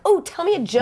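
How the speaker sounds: high, eager mock demand; pushy stranger impression. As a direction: pipe up like a thoughtless fan. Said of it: "when you tell somebody you're a stand-up comedian".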